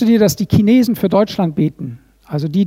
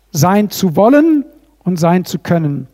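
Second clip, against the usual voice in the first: ordinary talk, nothing marked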